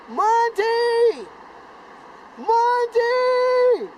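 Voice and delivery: high, loud calls of a name from far below; calling out. Retold or imitated. Boys on the river bank calling for their lost friend, high loud shouts.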